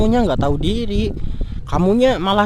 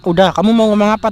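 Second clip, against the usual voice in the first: louder, challenging; loud demand; 3 semitones higher, 8 dB louder than usual. Acted. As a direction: demand she speak now. Menantang pacar menjelaskan. (menantang pacar dengan suara keras)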